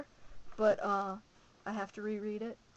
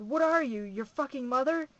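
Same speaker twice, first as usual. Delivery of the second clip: loud, higher angry roar; roared taunt; 4 semitones higher, 9 dB louder than usual. A narrator's reading. Metz roaring at the narrator to shame them, loud and angry. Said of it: "She roared".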